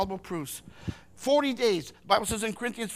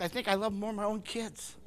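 ordinary delivery

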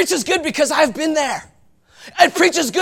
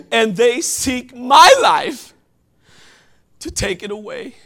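hushed despair; 2 semitones lower than usual. Acted. Elijah telling God he alone is left, hushed and broken. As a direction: let it fade like a man who has given up.

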